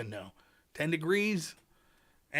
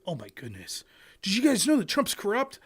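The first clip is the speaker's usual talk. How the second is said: ordinary talk, nothing marked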